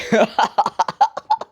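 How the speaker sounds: high-pitched hammed-up cackle; uproarious laugh. God in heaven watching Deku's power, uproarious mock laugh. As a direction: laugh your head off in put-on staccato bursts. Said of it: "God is in heaven laughing his ass off".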